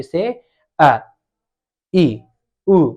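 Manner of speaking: each vowel clipped short and quick; quick, crisp short vowel demo; short vowels said quickly. Shown as a demonstration. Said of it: "री ऐसे बोलेंगे ना तो कम से कम समय लेना है".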